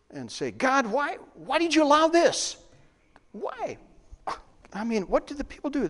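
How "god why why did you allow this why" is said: high-pitched protesting plea; mad at God. Acted. Believers questioning God in disappointment, high-pitched protest. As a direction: demand an answer, voice pitched well up. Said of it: "Do we get mad?".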